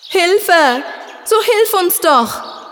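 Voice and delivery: high, slow pleading shout from inside the cave; distant desperate cry, drawn out; calls for help, clearer. A narrator's reading. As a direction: beg for help from below. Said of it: "drang es deutlicher an sein Ohr".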